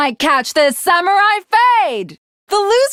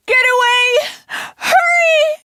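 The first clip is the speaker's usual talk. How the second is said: desperate shout; 9 semitones higher than usual. Acted. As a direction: scream at him to run. Urging escape from zombie.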